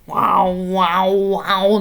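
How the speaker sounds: a slow, booming imitation of a frog crying out; frog call imitation. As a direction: croak it out like a big frog. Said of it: "おじさんみたいな声してるんです".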